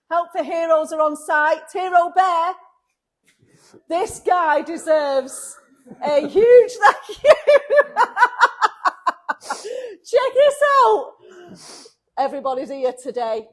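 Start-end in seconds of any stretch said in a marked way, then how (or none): 0.1-11.1 higher and louder, laughing with joy; overflowing with gratitude and excitement; thrilled. Real, not acted. Thrilled to show helpers on site.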